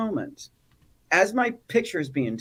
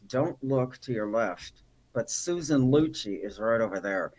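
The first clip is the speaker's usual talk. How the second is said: ventriloquist lips; 6 semitones lower, slower than usual. Retold or imitated. Spouse warning him on the red carpet, lips kept still. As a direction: talk without moving your mouth. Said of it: "like ventriloquist lips".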